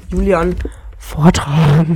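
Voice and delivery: overdriven, distorted mic sound; too close to the mic, clipping. Real, not acted. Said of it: "Das übersteuert grad sehr stark".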